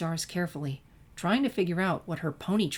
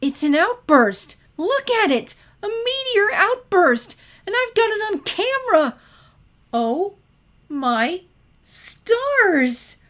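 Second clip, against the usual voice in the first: much higher and louder, squealing with joy; ecstatic; 10 dB louder, slower than usual. A narrator's reading. Twilight squealing to Sunset about the meteor outburst, ecstatic and much higher and louder. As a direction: shriek with delight at the meteors. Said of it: "Twilight's gasp could have been heard for miles".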